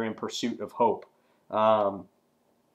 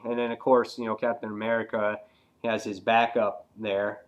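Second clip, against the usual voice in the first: ordinary talk, nothing marked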